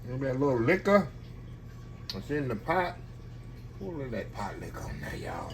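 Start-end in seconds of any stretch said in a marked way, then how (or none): 3.8-5.5 hushed aside. Real, not acted. Aside about pot liquor.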